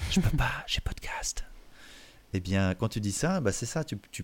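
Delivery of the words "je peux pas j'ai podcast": unvoiced whisper with a little laugh; secretive whisper. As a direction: hush it like a secret you can't tell. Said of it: "une posture un peu du secret". (chuchotement complice)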